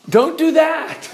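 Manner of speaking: high, slow, drawn-out warning; comic high-voiced reproof; mock scolding. Mock scolding after bad demo.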